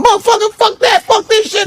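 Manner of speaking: high-pitched imitation of cussing actors; mock cast clamour. Actor mimicking everybody on Soul Plane, mock cast clamour. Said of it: "everybody was going".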